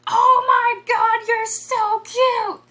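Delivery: put-on high, loud, slow drunk squeal of delight; shrieking drunk fan-favourite actress; screamed impression. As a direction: shriek it like a tipsy grandma pinching cheeks. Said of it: "and she screams".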